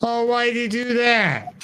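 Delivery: high, drawn-out mock exasperation; hammed-up backseat complaint; Shiver heckling. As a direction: whine it like an angry theater attendant yelling at the movie. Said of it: "Shiver's just in the background going".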